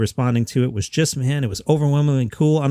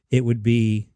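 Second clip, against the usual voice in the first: ordinary talk, nothing marked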